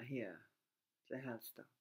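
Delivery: ordinary talk, nothing marked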